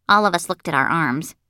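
ordinary delivery

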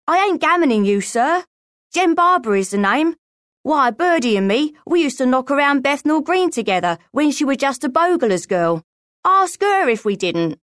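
high-pitched, insistent street urchin; protesting boy. A narrator's reading. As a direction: insist that you're telling the truth. Boy protests he knows Birdie.

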